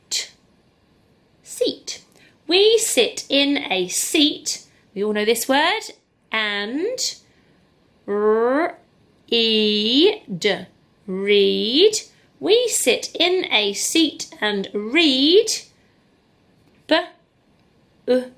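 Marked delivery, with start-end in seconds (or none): 2.5-4.7 slow, clear, word by word; slow reading. Shown as a demonstration. Teacher reading a sentence slowly aloud to pupils. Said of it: "modelling slow reading". 12.4-15.5 slow, clear, word by word; slow reading. Shown as a demonstration. Teacher reading a sentence slowly aloud to pupils. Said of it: "modelling slow reading".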